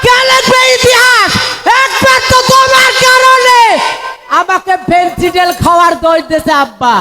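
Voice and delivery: high, pleading cries to father; anguished film hero. Acted. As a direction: wail it like a melodrama son. (উঁচু গলায় কান্নাভেজা আকুতি)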